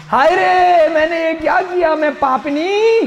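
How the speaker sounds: high, breast-beating mock lament; acted wailing. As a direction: wail like a widow beating her chest. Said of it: "छाती पीट पीट के". (छाती पीटती बंदरिया का ऊँचा, नाटकीय रोना)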